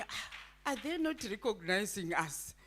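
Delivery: ordinary talk, nothing marked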